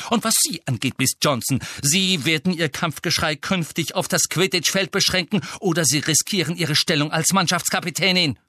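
high-pitched indignant reprimand; stern warning. Acted. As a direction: lay down the law to a pupil. Teacher turns reprimand on second pupil.

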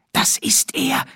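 breathless awed whisper; hushed, voiceless whisper. Acted. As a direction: whisper so the shape won't hear. Dean spots the shape.

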